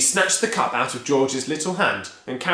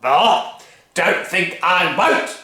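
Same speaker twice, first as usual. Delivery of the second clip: much higher, loud, slow bellowing; bully the whole family; furious Grandma; 6 semitones higher, 8 dB louder, much slower than usual. Acted. Grandma demanding her breakfast, loud bullying bellow. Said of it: "Grandma said".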